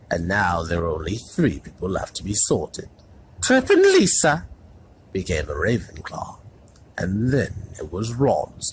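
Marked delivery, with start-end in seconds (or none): 3.4-4.5 high, loud announcing call; hat calling a name. A narrator's reading. The hat announces the next pupil, loud call.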